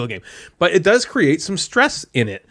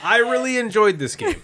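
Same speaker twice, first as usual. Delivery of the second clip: higher, laughing mock rave; gushing; 7 semitones higher than usual. Shown as a demonstration. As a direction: oversell it with a laugh. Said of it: "I'm about to do that".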